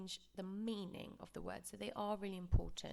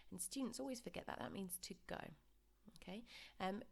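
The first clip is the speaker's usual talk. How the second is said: ordinary talk, nothing marked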